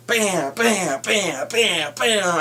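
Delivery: smash noises. Retold or imitated. Imitating Hulk smashing again.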